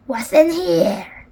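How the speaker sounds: slow, suspicious question; mildly menacing storybook pirate; demanding pirate captain. A narrator's reading. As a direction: demand an answer from a captive. Captain demands contents of pack.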